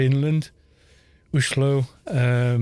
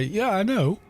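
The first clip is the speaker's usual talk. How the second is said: higher, carefree shrug of a reply; cheeky gamer admitting it; 7 semitones higher than usual. Retold or imitated. Ganker shrugging off being called mean, higher and carefree. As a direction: brush off the complaint lightly. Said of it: "But he would say".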